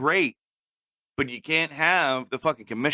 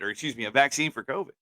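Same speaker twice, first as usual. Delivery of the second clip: normal talking voice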